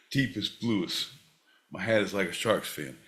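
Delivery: low, quiet, intimate crooning; lightly voiced smooth lover; a hint of a soft crooner. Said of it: "he looks at you and he says".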